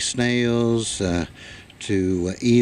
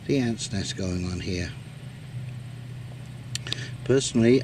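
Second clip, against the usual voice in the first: ordinary talk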